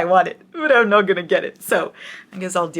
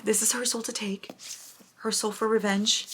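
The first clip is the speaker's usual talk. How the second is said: hushed reverent whisper; awed whisper; 2 semitones higher, 6 dB quieter than usual. Real, not acted. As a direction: whisper as if the books are sacred. Awed over new book editions.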